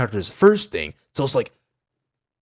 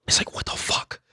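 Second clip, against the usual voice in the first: soft, voiceless bewilderment; whispered disbelief; 7 dB quieter than usual. Retold or imitated. Fan reacting to the chair from nowhere, whispered. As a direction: whisper it, dumbfounded.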